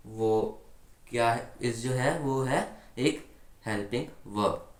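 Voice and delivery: usual speaking voice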